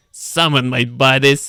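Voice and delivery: put-on slow, loud character voice for the young man; put-on gruff voice. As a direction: drag it out in a put-on tough voice. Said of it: "Why am I doing this voice?".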